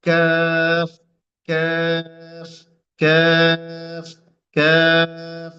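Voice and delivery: Arabic kaf, long and deliberate; Arabic letter kaf. Shown as a demonstration. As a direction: stretch কাফ with the tongue just ahead of the root. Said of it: "যে অক্ষরটা উচ্চারণ করবেন সেটা হচ্ছে".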